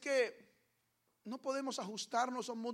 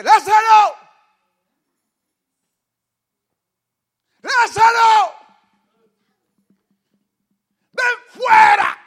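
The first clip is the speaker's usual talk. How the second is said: loud, high calling shout; 12 semitones higher, 25 dB louder, much slower than usual. Acted. Jesus calling Lazarus out of the tomb, shouting. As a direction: call him out of the tomb.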